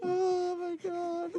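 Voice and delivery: laughing. Real, not acted. Cohost collapses in laughter.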